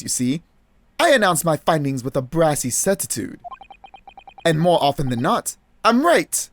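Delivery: hammed-up British sleuth explaining himself; smugly certain gentleman detective; pompous British detective, self-satisfied. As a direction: lecture grandly in an amateur British accent.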